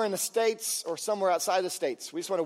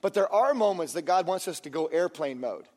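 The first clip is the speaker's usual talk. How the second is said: normal talking voice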